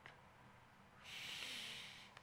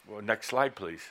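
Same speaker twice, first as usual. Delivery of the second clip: ordinary delivery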